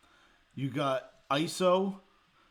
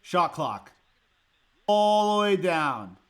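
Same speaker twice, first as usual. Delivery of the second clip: slow, loud and higher, dragging it out; exasperated; 6 semitones higher, 11 dB louder, slower than usual. Real, not acted. Fan watching the shot clock run down, slow exasperated outcry. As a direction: stretch each word in disbelief.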